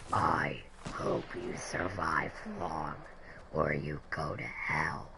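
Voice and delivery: very deep, slow, soft demonic whisper. Retold or imitated. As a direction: breathe the threat low like a demon. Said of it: "in a demonic voice".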